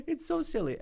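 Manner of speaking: high, giggly squeak; real laugh squeezed into the words; through laughter. Real, not acted. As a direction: talk while cracking up. Still laughing at the accent.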